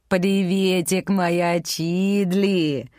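slow sing-song cooing to a dog; cutesy baby talk. Acted. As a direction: coo it slowly like to a puppy. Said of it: "пропела Химена на особом сюсюкающем языке".

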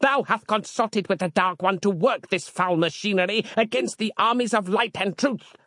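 high-pitched fanatical accusation; high, hammed-up zealot's tirade; yelled denunciation. A narrator's reading. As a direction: denounce like a witch-hunting preacher. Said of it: "yelled Harland".

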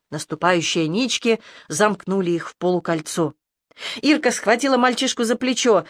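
ordinary delivery, nothing marked

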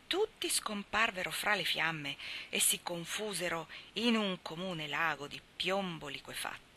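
usual speaking voice

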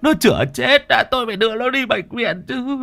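high, breaking pleas between sobs; father refusing to let go, sobbing; sobbing desperation. Acted. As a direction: beg to take her to hospital, half-choked. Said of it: "Ông nấc lên từng tiếng".

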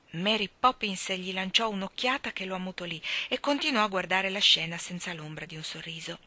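ordinary delivery

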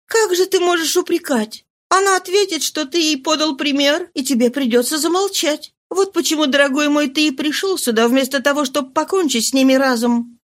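lightly voiced consoling mistress.

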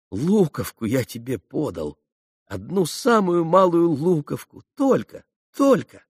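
tender voice climbing high with emotion; moved, tearful insistence; near tears. A narrator's reading. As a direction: choke up on the last words. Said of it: "сам заплакал".

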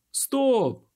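high, loud cry to halt; sudden ringing yell; loud ringing shout. A narrator's reading. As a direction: shout to stop everyone. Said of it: "крикнув голосно і дзвінко дикун".

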